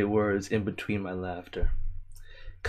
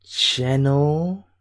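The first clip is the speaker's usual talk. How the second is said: slow, loud, higher disbelief at a name; 5 semitones higher, 7 dB louder, slower than usual. Real, not acted. Reading a viewer's name out in loud disbelief.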